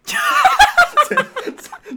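shrill, loud, long cackling laugh; over-the-top cackle as the Chinese spirit; exaggerated laugh. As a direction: burst into a huge hammy laugh. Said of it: "那你变中国的好".

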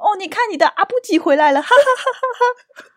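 high, self-amused grandma cackle; cackling grandma. Retold or imitated. Grandma laughing at her own joke as dad walks in, high and cackling. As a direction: crack up at your own joke.

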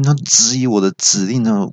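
ordinary talk, nothing marked

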